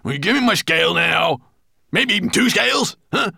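high, excited little dwarf. A narrator's reading. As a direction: clamour excitedly for your reward. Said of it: "so excited that he dropped the brush".

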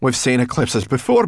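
babbled. A narrator's reading. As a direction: babble it out anxiously. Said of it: "babbled Sorrel".

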